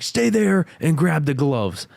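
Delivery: slow, high challenge; mock threat. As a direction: dare him to fight, slowly. Boss challenging to fight, retold.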